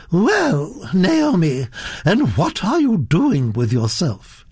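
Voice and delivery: high, swooping grande dame question; lofty Duchess interrogation; imperious Duchess. Acted.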